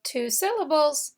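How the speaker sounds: normal talking voice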